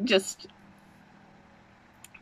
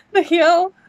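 excited; 9 semitones higher, 5 dB louder than usual. Real, not acted. Reacting to trailer.

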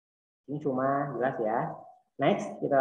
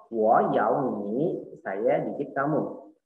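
"wo yao ni" drawn out slowly, tone by tone; slow, careful model sentence; slow demo sentence; much slower than usual. Shown as a demonstration. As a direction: spell it out slowly for students. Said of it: "coba, coba".

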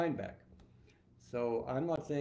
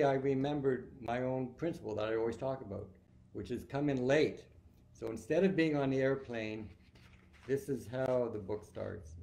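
plain reading voice

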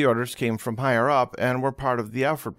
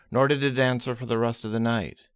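ordinary delivery, nothing marked

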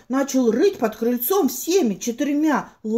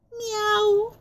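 cat meow; 10 semitones higher than usual. Acted. Mysterious meow again.

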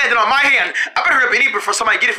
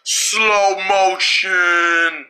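very slow, low, stretched words; slow motion; 6 semitones lower, much slower than usual. Pretend. Player announcing slow motion, low dragged-out voice. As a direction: drag it out like slowed-down film.

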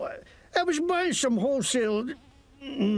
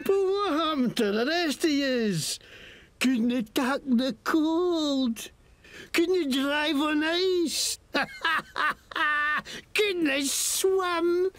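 lightly Scottish mocking thug; Scottish accent. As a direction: sneer at them in Scots. GM plays a Scottish NPC.

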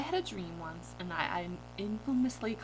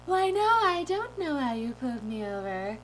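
higher, put-on innocent voice; silly dream-self voice; 4 semitones higher, 4 dB louder than usual. Driver denying all to the officer, goofy innocent voice. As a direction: feign innocence in goofy tones. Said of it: "I'm talking like this".

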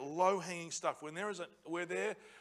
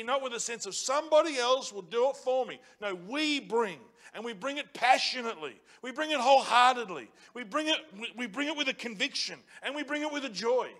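higher, louder passionate refrain; climactic preaching; 5 semitones higher, 6 dB louder than usual. Acted. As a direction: hammer each "we bring it" harder.

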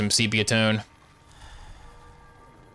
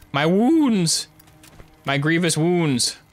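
hammed-up pained cry, pitched higher; mock wounded hero; 6 semitones higher, much slower than usual. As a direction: ham up a grand dying warrior. Mock hurt after being staggered.

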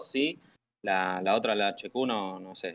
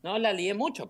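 protesting; 8 semitones higher, 5 dB louder than usual. Real, not acted. Protesting he didn't overreact.

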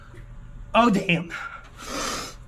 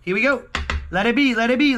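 normal talking voice

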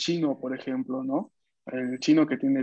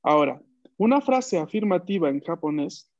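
ordinary talk

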